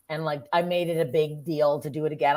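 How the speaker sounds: usual speaking voice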